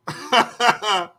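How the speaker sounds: loud, high cackling laugh; burst of laughter. Real, not acted.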